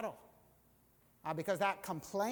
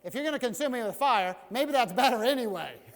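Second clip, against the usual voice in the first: louder, half-shouted dare; fed-up Moses; 5 semitones higher, 9 dB louder than usual. Retold or imitated. Moses daring God to consume him, loud and fed up. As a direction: tell God to just finish it. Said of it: "Moses is like".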